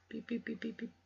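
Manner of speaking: high mock phone beeping; phone beeps. Real, not acted. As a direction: imitate a dialing phone. Imitating a phone call.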